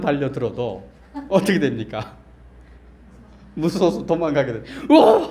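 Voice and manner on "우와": loud, high mock scream of fright; acted scared yell. Preacher acts out fleeing a charging dog, mock terrified yell. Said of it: "하면서 막".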